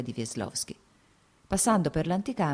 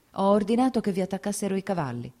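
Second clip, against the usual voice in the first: higher, louder, firm declaration; resolute; 5 semitones higher, 4 dB louder than usual. Acted. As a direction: state it decisively, eyes fixed on him. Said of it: "guardandolo risoluto negli occhi".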